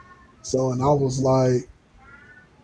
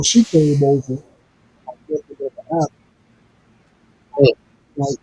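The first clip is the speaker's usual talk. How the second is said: tape dropout. Real, not acted. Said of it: "the audio cutting out".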